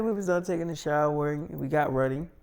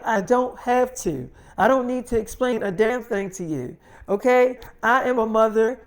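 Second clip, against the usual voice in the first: heated, higher-pitched self-defence; defiant retort; 5 semitones higher, 4 dB louder than usual. Retold or imitated. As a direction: imitate a daughter standing her ground. Daughter defies mother in quarrel.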